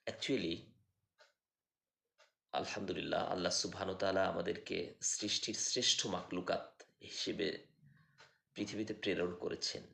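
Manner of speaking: ordinary talk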